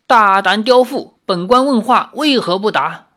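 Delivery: 大声喝斥, 声音更高更响; 像坐堂老爷拍案怒喝. A narrator's reading. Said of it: "太守又喝道". (太守大声喝斥犯妇)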